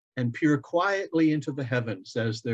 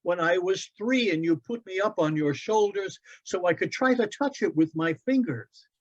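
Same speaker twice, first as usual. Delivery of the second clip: put-on higher, eager boy's voice; young boy Loren; 8 semitones higher than usual. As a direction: play the son, bright and eager. Child voice held by narrator.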